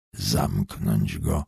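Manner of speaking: very low, slow, hushed hoarse order. A narrator's reading. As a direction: give the order softly in a deep, hoarse voice. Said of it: "mówi ochrypłym głosem Jakub". (cichy, niski, ochrypły głos)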